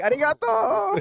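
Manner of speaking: high, drawn-out mock-Italian arigato; Italian accent. Imitating himself speaking Japanese like an Italian guy, exaggerated Italian accent. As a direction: stretch it out in a big Italian accent. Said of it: "Italian guy, though".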